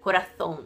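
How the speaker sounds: "coração" as "corathón", tongue between the teeth; Castilian lisped C in "coração"; Spain's TH sound. Shown as a demonstration. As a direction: say "coração" with an English TH for the C. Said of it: "como se fosse o T H do inglês".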